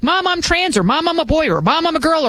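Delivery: high, lightly voiced child announcing; a hint of a whiny teen; mock kid voice. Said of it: "a kid comes home and they're like".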